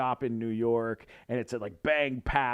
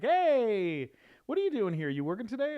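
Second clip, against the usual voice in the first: higher-pitched, pleasantly surprised greeting; friendly surprise; 10 semitones higher, 3 dB louder than usual. Retold or imitated. Actor greeting a print-shop worker, mildly surprised. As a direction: lift the pitch as if spotting a coworker.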